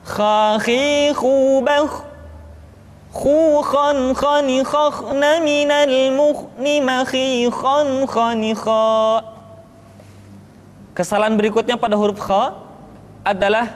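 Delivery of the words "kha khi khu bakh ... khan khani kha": chanted drill, higher and held; drilled kha syllables. Shown as a demonstration. Teacher chanting the kha drill for students. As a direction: recite each kha syllable like a Quran teacher. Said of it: "Perhatikan latihan berikut ini".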